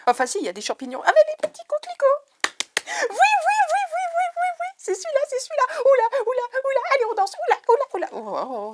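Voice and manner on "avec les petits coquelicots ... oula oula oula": couinements très aigus et plus forts; surexcitée. Real, not acted. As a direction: danser de joie en piaillant. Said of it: "faut qu'elle se calme". (piaille de joie, voix très aiguë)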